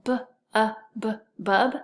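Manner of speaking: "b, uh, b" separated, then "bub"; tapping out sounds. Shown as a demonstration. Teacher sounding out the first syllable, piece by piece. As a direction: break "bub" into sounds, then join them. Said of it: "first syllable".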